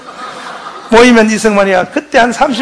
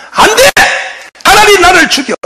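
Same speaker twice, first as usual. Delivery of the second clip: defiant refusal; 7 semitones higher than usual. Retold or imitated. Rhee defying America, life at stake.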